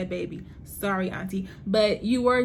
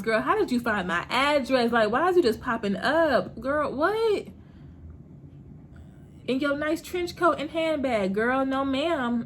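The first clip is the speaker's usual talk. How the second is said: sassy callout; 4 semitones higher than usual. Retold or imitated. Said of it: "my whole thing was".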